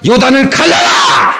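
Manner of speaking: high, drawn-out battle cry; slow, ringing command; commanding shout. Acted. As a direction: shout it as an order to the river. Preacher acting out a bold command.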